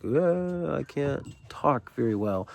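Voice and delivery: slow, put-on can't-talk voice; mock someone struggling to talk; drawn-out groan sliding into slow mock speech; funny talker. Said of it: "it just sounds kinda funny".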